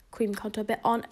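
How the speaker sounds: ordinary talk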